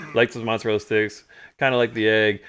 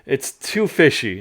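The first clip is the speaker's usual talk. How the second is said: loud, higher grossed-out complaint; disgusted rant; 7 semitones higher, 9 dB louder than usual. Retold or imitated. Dad telling his son the soup is awful, loud disgust. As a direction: pile on the disgust, groan at ugh. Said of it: "And he's like".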